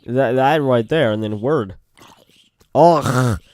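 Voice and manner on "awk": loud punchy Awk; barked awk. Shown as a demonstration. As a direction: bark out Awk. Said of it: "is weird".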